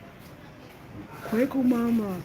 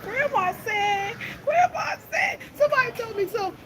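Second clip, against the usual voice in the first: much higher squealing delight; gleeful squeal; 11 semitones higher than usual. Real, not acted. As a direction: squeal out the words laughing.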